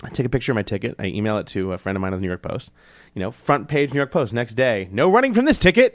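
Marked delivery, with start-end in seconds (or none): 4.9-5.9 high, loud tabloid headline voice; high, loud mock headline; headline read. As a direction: announce it like a splashy front page.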